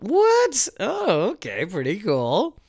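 hammed-up confused fan; mock surprise. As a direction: act baffled then shrug it off. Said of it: "where you're like".

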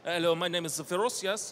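overdone foreign character voice, rising; vague Germanic accent. Retold or imitated. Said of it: "an offensively vague Germanic".

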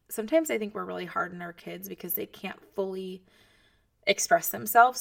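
normal talking voice

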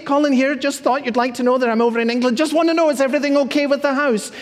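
the pastor plays himself fussing at the house sitter, chirpy; fussy phone caller. Retold or imitated. A bright, over-eager caller checking in too soon. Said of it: "I say".

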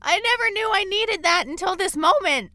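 very high, very loud fan outburst; overjoyed shrieking. Real, not acted. Fan thrilled by Lion King casting, overjoyed shrieking. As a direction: shriek with delight, then shout it out.

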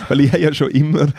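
usual speaking voice

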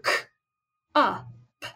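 k and p of cup said apart, one at a time; segmented sounds. Shown as a demonstration. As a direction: break cup into single sounds. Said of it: "How many sounds do you hear in cup?".

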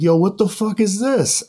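high, stunned burst of disbelief; retelling a friend's stunned reaction, high and incredulous; shocked disbelief. Retold or imitated. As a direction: blurt it out like you can't believe your eyes. Max stunned by the video.

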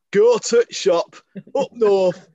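loud, slow, high broad northern; northern accent. Retold or imitated. Mocking how southerners hear her, broad loud northern accent. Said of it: "They call me Peter Kay".

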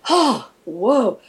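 shaking off a scare with a breathy whoa; mock relief. To listeners after a scary movie, a light relieved whoa.

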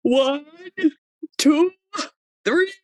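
imitating a small child counting, high; counting slowly in a child's voice, high-pitched; little daughter counting. Mother imitates daughter counting to calm herself, childlike. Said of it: "She'd go".

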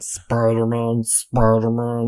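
slow, even robot chant; robotic vocoder voice. Retold or imitated. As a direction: drone it like a vocoder. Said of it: "they use a vocoder the entire time".

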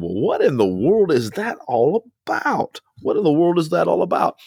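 incredulous imagined listener; puzzled listener. Retold or imitated. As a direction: ask like a baffled congregant.

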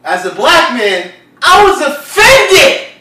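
loud, indignant proclamation; booming declaration of offense; belted from the diaphragm. Real, not acted. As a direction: project it hard, building to a drawn-out shout. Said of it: "put a little bit of diaphragm".

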